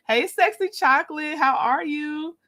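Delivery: ordinary talk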